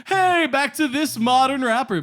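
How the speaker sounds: high, mock-excited music intro; hype DJ. Pool DJ introducing a rapper, high and hyped-up. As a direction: call out the next track in a hammed-up way. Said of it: "any rapper to be like".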